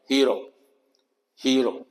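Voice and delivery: हीरो said smoothly with an ee-uh sound; pronunciation demo. Shown as a demonstration. Teacher showing the smooth version of हीरो. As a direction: blend हीरो with no gap. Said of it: "आवाज ई अ की आएगी".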